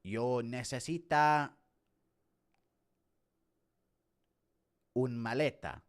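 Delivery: slow, halting beginner's Spanish; Spanish lesson. Acted. Learner showing off today's Spanish lesson, halting Spanish. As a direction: recall Spanish word by word, slowly. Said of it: "What did I learn today in my Spanish lesson".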